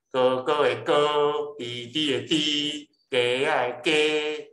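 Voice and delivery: 哥, 弟, 鸡 held long on a high, even pitch; high level first tone. Shown as a demonstration. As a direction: stretch the last 哥, 弟, 鸡 out level, never falling. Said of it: "这拢第一声哦". (「哥、弟、鸡」第一聲，懸平拖長)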